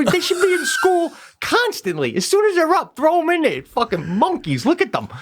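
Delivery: high-pitched comic exasperation; mock ranting. Dad retelling how he and wife mocked kids, mock ranting. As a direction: rail at the kids in hammed-up despair.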